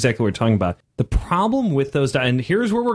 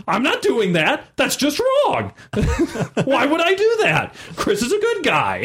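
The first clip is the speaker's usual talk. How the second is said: put-on seven-year-old refusing; 10 semitones higher than usual. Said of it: "Sky, being seven".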